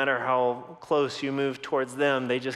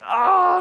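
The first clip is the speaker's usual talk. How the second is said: high loud exasperated "oh"; frustrated outcry; 10 dB louder than usual. Retold or imitated. Dad rattled by his son's denial, crying out. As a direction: let out a pained cry of frustration.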